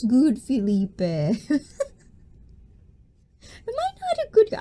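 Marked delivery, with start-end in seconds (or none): 3.7-4.6 high, cutesy questioning voice. Pretend. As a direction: play the sweet innocent girl. Cutesy self-praise to chat.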